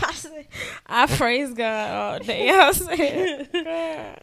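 slow, laughing thanks to God; laughing praise. Real, not acted. Test came back negative, laughing relief.

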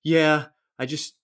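slow, easy reassurance; downplay it calmly; calm reply. Retold or imitated. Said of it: "or I didn't say it like this".

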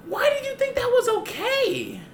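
high mock whiny girl voice; imitating the upset girl. Girl asking why he took her cat, high mock whine. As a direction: whine the question like a hurt kid.

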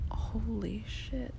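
a startled whispered curse. Real, not acted. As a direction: jump at the thunder. Startled by thunder.

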